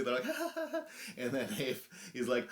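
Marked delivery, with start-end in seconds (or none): 0.3-0.8 high, forced mock laughter; put-on nervous ha-ha, pitched way up; imitated nervous laugh. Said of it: "everyone's laughing, like, nervously".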